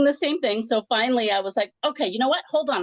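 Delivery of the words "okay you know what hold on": retold fed-up interruption, pitched up; frustrated, higher-pitched retelling; mad and frustrated. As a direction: cut in with mild exasperation. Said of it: "I was getting very mad and frustrated".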